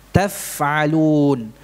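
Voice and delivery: slow Quranic demo of "Taf'alun"; drawn-out recitation. Shown as a demonstration. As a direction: stretch "Taf'alun" slowly with a light f. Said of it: "bukan taf'alun".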